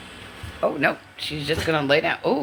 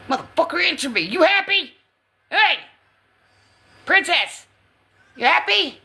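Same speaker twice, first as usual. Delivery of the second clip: high, loud mock demand; playful high dog talk; 12 semitones higher, 3 dB louder than usual. Real, not acted. [name] playfully demands that Pride answer her, high and loud.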